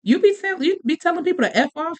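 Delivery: mock-casual, joking question to a kid; jokingly. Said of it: "So I asked him jokingly".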